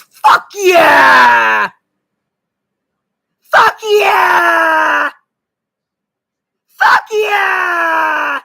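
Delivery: long raw shouted cry; triumphant scream. Real, not acted. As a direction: scream it to the rafters. Repeated triumphant yelling.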